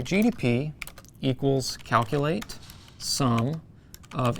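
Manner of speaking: slow, spelling out code; dictating. Shown as a demonstration. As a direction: dictate each character carefully. Reading a formula aloud.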